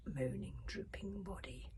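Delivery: ordinary delivery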